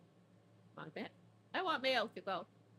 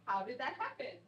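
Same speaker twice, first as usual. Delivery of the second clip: softer, higher, lightly voiced feigned innocence; mock innocent cats; 5 semitones higher, 5 dB quieter than usual. Voicing the nonchalant cats after knocking the rack, mock innocent. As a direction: play the cats shrugging it off innocently.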